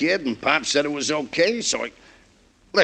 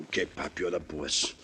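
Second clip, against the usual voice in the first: much lower and softer, defeated; sullen giving in; 9 semitones lower, 12 dB quieter than usual. Acted. Joey gives in to his manager Pop, quietly defeated. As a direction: mumble it, beaten down.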